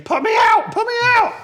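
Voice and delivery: high, loud, panicked shouting; screaming for help. Acted. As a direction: yell it while burning. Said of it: "something else scream and start shouting".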